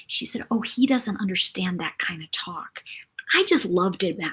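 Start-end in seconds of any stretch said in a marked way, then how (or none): 0.5-2.8 whisper. Retold or imitated. Little sister answering the store owner, hushed whisper. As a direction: tell it like a secret. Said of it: "whispers in his ear".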